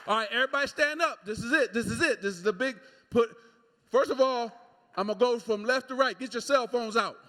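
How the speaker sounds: loud, high, fast hype call to the crowd; rally the whole crowd loudly; booming crowd call. Real, not acted. Addressing crowd for photos.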